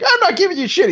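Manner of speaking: high, scornful put-on voice; mock indignant refusal. The customer refuses to tip Leon, mock scornful.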